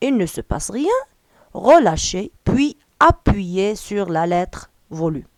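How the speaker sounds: slow, deliberate instruction reading; slow dictation read. Acted. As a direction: read each French word out slowly and clearly, like dictating to a class. (قراءة تعليمية بطيئة ومتأنية)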